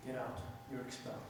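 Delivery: very low, soft expulsion; hushed, final order from a sheikh; quiet dismissal. Retold or imitated. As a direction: dismiss the student without raising your voice. Sheikh calmly expels the student.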